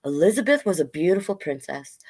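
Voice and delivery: ordinary delivery, nothing marked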